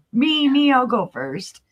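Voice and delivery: high, eager me-first squeal; eager volunteer. Retold or imitated. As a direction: jump in like an overeager kid.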